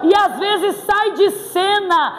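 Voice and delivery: preaching shout. Real, not acted. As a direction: fire it at the crowd. Pregação exaltada. (grito de pregador)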